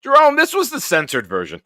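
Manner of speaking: high, loud laughing through the words. Real, not acted. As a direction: break up laughing at your own rant. Laughing after his own rant.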